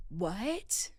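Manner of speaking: quiet, rising what; a soft, baffled question; stunned disbelief. Retold or imitated. Storyteller reacting to his absurd text, stunned.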